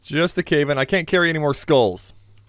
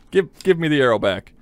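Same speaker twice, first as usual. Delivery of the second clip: ordinary talk, nothing marked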